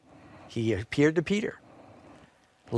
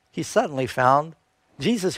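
ordinary talk, nothing marked

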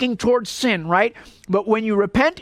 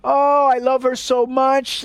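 slow, higher, whiny mock sobbing plea; mock crying; 4 semitones higher, slower than usual. Preacher mimics a caught cheater, mock weepy whine. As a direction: ham up a cheating husband's weepy lament. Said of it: "this guy came crying".

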